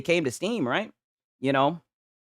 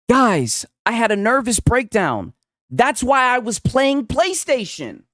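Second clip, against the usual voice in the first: loud, high, hammed-up confession; mock confession; 7 semitones higher, 4 dB louder, slower than usual. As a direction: plead your excuse to the crowd, mockingly. Mock reading of [name]'s confession.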